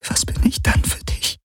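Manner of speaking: voiceless, trembling-hearted whisper; hurt whisper. Acted. She asks what she means to him, hurt whisper. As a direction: whisper the question, wounded. Zitternd fragt sie nach seinen Gefühlen.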